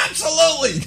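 laughing through absolutely, soft; cracking up mid-word; real laughter on absolutely. Real, not acted. Laughing after own punchline.

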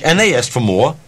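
ordinary talk

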